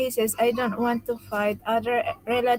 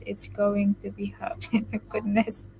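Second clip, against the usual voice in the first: ordinary talk, nothing marked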